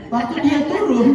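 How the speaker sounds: laughing through the words; can't hold back a chuckle mid-story; giggling while telling it. Real, not acted.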